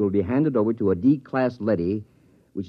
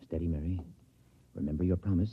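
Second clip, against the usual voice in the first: steady her quietly; father hushing his frightened daughter; hushed reassurance; 8 dB quieter, faster than usual. Acted. Father calms daughter before execution.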